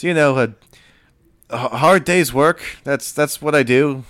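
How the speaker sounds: lightly voiced lad showing off his work; young boy. Deckhand Enrique shows the captain he's busy, lightly boyish voice. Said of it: "making it very visible that he's doing something".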